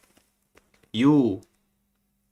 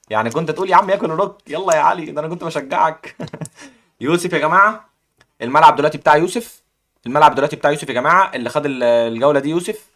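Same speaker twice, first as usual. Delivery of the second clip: ordinary delivery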